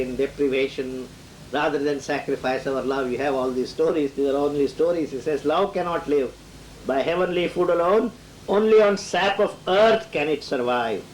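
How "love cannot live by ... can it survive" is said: building verse reading, rising louder to the end; reciting Death's words, mounting emphasis; poem recitation. Shown as a demonstration. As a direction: declaim it, growing in force. Quoting Death's lines from poem.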